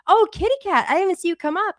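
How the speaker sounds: high, sweet pet voice; cat talk. Real, not acted. To the cat who just walked up, a high sweet coo. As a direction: coo at the kitty.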